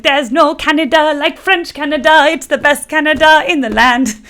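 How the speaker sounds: high, loud mock French Canadian singsong; hammed-up French Canadian accent, easing down at the end. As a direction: belt it like a cartoon French Canadian. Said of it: "singing their little song".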